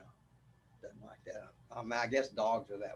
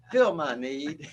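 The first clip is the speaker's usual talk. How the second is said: louder, laughing cat voice; playing the cat's demand through a laugh; 3 semitones higher, 6 dB louder, slower than usual. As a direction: demand it like the needy kitten. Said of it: "she uses one word to say that".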